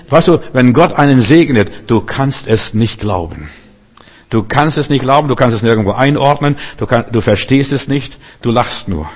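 ordinary talk, nothing marked

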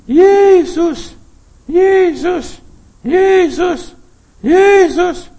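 repeated high, very loud cries; proclaiming shout. Shown as a demonstration. Preacher urging listeners to cry the name, repeated shouts. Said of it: "Ruf doch, schrei doch, proklamiere".

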